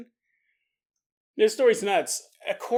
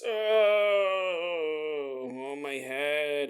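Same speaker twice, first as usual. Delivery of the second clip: mock groan; 5 semitones lower, 5 dB quieter, much slower than usual. Injured man groaning.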